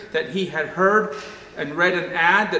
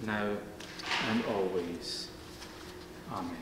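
soft, low, reverent prayer voice; 8 semitones lower, 8 dB quieter than usual. Acted. Pastor leading the congregation in prayer, soft and low.

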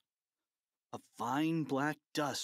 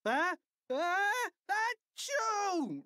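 echoed fake sneeze; much slower than usual. A narrator's reading. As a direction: chant the sneeze back like a crowd. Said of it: "repeated the fans".

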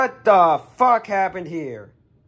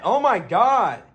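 ordinary talk

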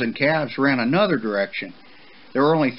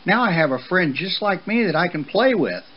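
high-pitched, happy colt; delighted with a new playmate; delighted young colt; 8 semitones higher than usual. Acted. Young horse thinking of his new friend, high and excited.

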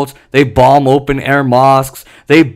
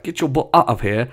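soft, lower mock British accent; put-on British accent; 5 semitones lower, 6 dB quieter than usual. Imitating how Brits might send Biden away, British accent. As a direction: say it as a joking Brit, quietly. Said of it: "I'm sorry for my British accent".

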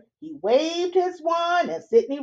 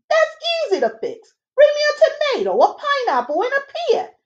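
high, chirpy little fairy man; fairy godfather; 14 semitones higher, faster than usual. Acted. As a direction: squeak it out like a tiny busy fairy. Fairy godfather orders fruit.

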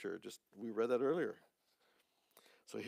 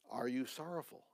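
ordinary delivery

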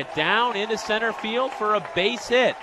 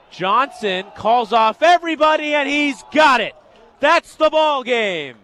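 loud, high play-by-play voice; elated sportscaster closing out the game; triumphant final-out call; 7 semitones higher, 9 dB louder than usual. Real, not acted. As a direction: announce the win with full excitement. Calls the final out live.

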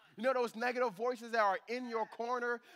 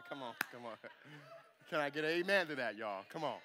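laughing plea; 6 semitones lower than usual. Real, not acted. Asks congregation for amen.

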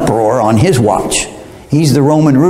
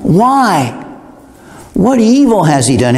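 Pilate pleading; 5 semitones higher than usual. Retold or imitated. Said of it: "he said unto them the third time".

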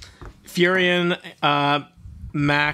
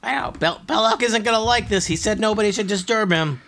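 high nervous goblin; 8 semitones higher than usual. Acted. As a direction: whine it nervously.

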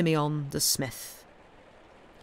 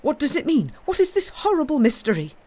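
high, loud, horrified demand; distraught outcry; 6 semitones higher, 6 dB louder, faster than usual. A narrator's reading. Man demanding answers about his brother's death, distraught. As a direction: cry out in shocked grief, raised pitch.

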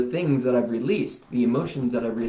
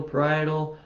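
usual speaking voice

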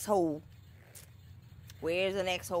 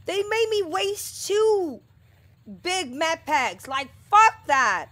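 higher, louder furious rant over wasted packs; angry rant; 8 semitones higher, 6 dB louder than usual. Real, not acted. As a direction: rage at losing med packs. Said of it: "of course I'm angry".

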